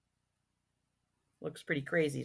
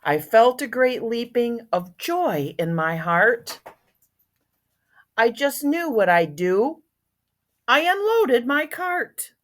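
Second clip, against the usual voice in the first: animated, louder storybook reading; story narration; 8 semitones higher, 14 dB louder, slower than usual. A narrator's reading. Once-ler recalling his leaping joy, animated storybook narration. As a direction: read it out with bouncing emphasis.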